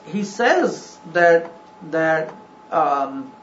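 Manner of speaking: normal talking voice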